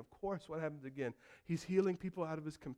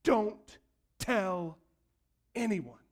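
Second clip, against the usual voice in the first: a firm, louder warning; stern command; 4 semitones higher, 7 dB louder, much slower than usual. Retold or imitated. Jesus ordering the healed leper, stern. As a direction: lay down the order firmly.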